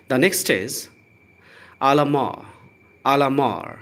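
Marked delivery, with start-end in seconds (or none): 1.8-3.8 "alamar" with a final R and no T sound; French pronunciation. Shown as a demonstration. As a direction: model "alamar", with the T silent. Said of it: "आर का sound र आपको बोलना है". ("alamar" को French ढंग से, बिना "ट" के)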